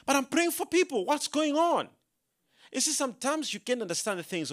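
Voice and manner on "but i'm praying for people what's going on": puzzled appeal, pitched well up; retold bafflement. Preacher recalling his confusion while praying for people, baffled. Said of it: "And I was like".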